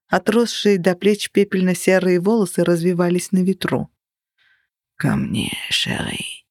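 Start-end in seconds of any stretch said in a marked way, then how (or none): 5.0-6.4 low, whispered beckoning. A narrator's reading. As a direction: call her close in a whisper. Figure beckons her.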